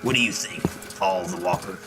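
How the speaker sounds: ordinary talk, nothing marked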